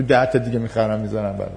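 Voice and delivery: ordinary talk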